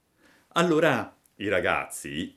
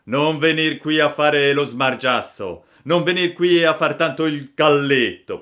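loud, raised-pitch bullying challenge; angry shout; 6 semitones higher, 9 dB louder than usual. A narrator's reading. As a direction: shout him down as gang leader. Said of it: "Gridò allora il più grande". (grido da capobanda)

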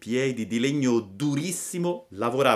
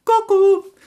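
very high, loud cuckoo call; piping sing-song jeer; mocking taunt; 12 dB louder than usual. A narrator's reading. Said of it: "in segno di canzonatura". (cucù canzonatorio)

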